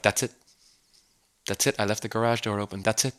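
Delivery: soft, offhand brush-off; uncaring dad waving off his wife, quiet and dismissive. Retold or imitated. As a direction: mumble it to shut her up. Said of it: "the dad, just keeps going".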